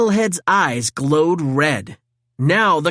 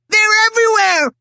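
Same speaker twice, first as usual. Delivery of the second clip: high, loud panicked yell; panicked shout; 5 dB louder than usual. A narrator's reading. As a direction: scream the warning. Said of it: "Michelangelo shouted".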